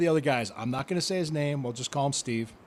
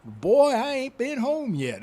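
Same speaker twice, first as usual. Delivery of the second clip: high, drawn-out Georgia drawl; slow Southern Georgia accent, stretched vowels; Big Boss Man's Georgia accent; 11 semitones higher, 7 dB louder, slower than usual. Retold or imitated. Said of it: "the way he had that Georgia accent".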